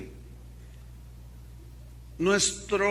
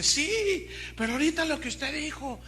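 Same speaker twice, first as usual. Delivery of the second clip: put-on high-pitched young woman protesting; imitated upset girl; 8 semitones higher than usual. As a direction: act out her upset objection in a raised pitch. Imita a la joven angustiada. (imitando a una joven alterada, voz aguda)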